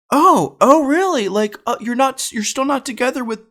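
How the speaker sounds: very high put-on voice of dismay; mock surprised aunt. Aunt learns of breakup, high mock surprise.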